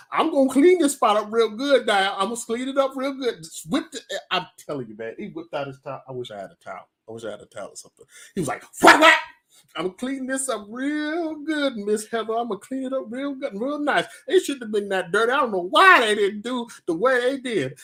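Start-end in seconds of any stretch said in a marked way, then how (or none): 0.1-3.4 high-pitched, over-eager worker voice; eager cleaner. Retold or imitated. Cleaner promising the boss a good job, eager mock voice. 8.8-9.1 loud, high imitated towel snaps; towel whip. Cleaner whipping out his towel, loud snapping noises. 9.8-17.8 high, sing-song eager worker voice; eager cleaner. Retold or imitated. Cleaner reassuring Miss Heather, high eager mock voice.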